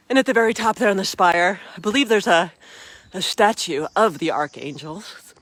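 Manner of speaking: usual speaking voice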